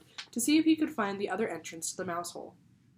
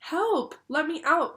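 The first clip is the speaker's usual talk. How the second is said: high, loud panicked cry; frightened cry for help; 9 semitones higher, 8 dB louder, slower than usual. A narrator's reading. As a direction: yell it trapped and scared. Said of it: "cried Stuart".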